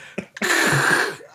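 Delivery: unvoiced, harsh imitation of grinding gears; grinding gears noise. Game master voicing a clumsy gear change, grinding noise. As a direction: make the crunch of a bad gear change. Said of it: "Grinding gears".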